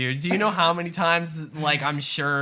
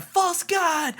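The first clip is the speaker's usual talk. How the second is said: mock shout. Retelling a man confronting Russell, mock shout. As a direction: imitate an accuser crying out, voice jumping up. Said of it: "he stood up and shouted".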